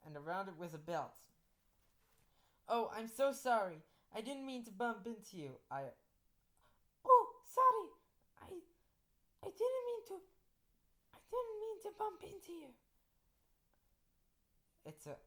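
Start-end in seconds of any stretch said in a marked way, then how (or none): 2.7-5.5 higher, urgent apology; apologetic. Acted. Teacher bumps into a ghost girl, apologetic. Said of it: "I apologized". 7.1-12.7 very high-pitched flustered apology; apologetic. Acted. Teacher bumps into a ghost girl, squeaky apology. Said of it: "I apologized".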